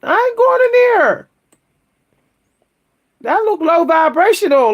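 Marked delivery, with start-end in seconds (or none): 0.0-1.3 high-pitched, indignant refusal; adamant refusal. Acted. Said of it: "I would have been like".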